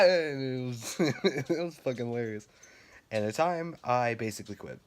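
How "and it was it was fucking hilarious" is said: real laughter through the words; higher-pitched, breaking into a laugh while talking. Real, not acted. Recalling the scared guests in the maze, laughing. Laughs at his own story.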